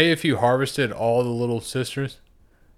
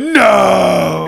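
long, high, loud wail; mock horrified shout; 9 semitones higher, 11 dB louder than usual. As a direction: howl it in hammed-up despair. Acting out player's horrified reaction.

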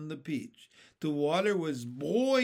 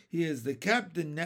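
ordinary delivery

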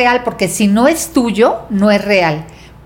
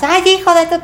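mock-angry cry, pitched way up; angry outburst; 10 semitones higher, faster than usual. Imagining a friend dumped by a partner, angry. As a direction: act out a flash of anger at being dumped. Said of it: "Y tú te enojas".

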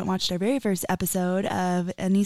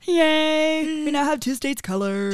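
higher, drawn-out singsong yay; playful cheer; 7 semitones higher than usual. Pretend. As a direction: celebrate a tiny achievement in singsong. Mock celebration of coloring.

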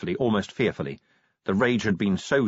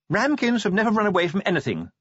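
high-voiced lady making a declaration; declaring; 12 semitones higher, 3 dB louder than usual. A narrator's reading. Sybil defending her family's record, declaring. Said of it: "Sybil declared".

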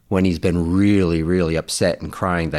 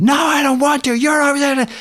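very high-pitched mock tantrum; hammed-up child's upset refusal; upset daughter; 4 dB louder than usual. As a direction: imitate a kid whining in protest. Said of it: "she was really upset like that".

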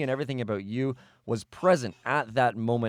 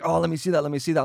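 higher-pitched eager plea, imitating a friend; excited; 5 semitones higher, 4 dB louder, faster than usual. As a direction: act out a friend's excited begging. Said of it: "you're so excited".

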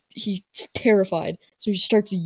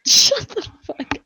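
much higher, loud, cracking with laughter; giggling shut up; laughing protest; 13 semitones higher, 5 dB louder than usual. Real, not acted. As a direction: laugh it out at a friend's dumb joke. Laughing at friend's joke.

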